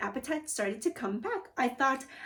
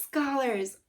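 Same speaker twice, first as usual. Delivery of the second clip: ordinary delivery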